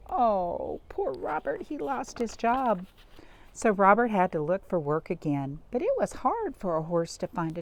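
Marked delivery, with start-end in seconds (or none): 0.1-2.9 sympathetic. Acted. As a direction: feel sorry for him. Sympathy for Robert.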